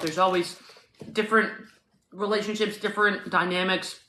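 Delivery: ordinary talk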